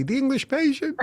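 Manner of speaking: high, weepy title; tearful answer. Retold or imitated. Crying woman telling the stranger the film. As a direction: sob the title out.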